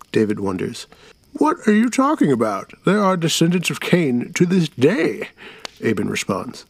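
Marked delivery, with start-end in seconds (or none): 1.3-5.3 much higher, indignant; indignant old storyteller. Acted. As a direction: brush off the kid's doubt. Aben defends himself.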